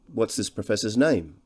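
ordinary delivery

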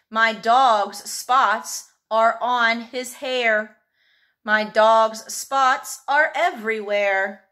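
slow, sing-song read-aloud; reading to children. Acted. Teacher reading a phonics rhyme to young pupils, slow and lilting. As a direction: read it slowly so little ones follow.

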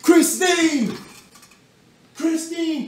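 high call across the house. Real, not acted. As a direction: shout her name to the next room. Calling girlfriend from another room.

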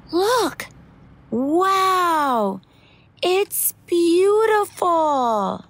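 high, slow, awestruck exclamations; excited wonder. Acted. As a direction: marvel at it wide-eyed. Character marvels at car lights.

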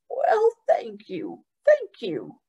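put-on slow, breathy grateful old man; old man's thanks.

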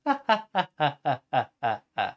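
long staccato acted cackle; a drawn-out mock "ha ha ha"; laughing cat. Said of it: "laughed the cat".

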